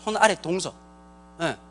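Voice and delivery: ordinary delivery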